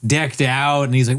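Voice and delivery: ordinary talk, nothing marked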